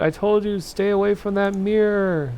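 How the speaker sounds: high, loud outburst; shouted warning. Acted. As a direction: shout it as an urgent reproach. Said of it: "I shouted".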